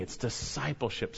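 ordinary delivery

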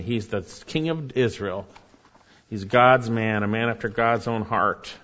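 usual speaking voice

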